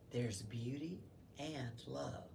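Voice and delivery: soft and slow; gently, with love; soft, tender reassurance. A narrator's reading. Mary comforting Joseph.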